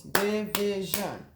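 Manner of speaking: "Division" slowly split into three beats; syllable by syllable. Shown as a demonstration. Teacher counting syllables for pupils, "Division" in three separate beats. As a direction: say "Division" as di, vi, shun with gaps. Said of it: "That's three syllables".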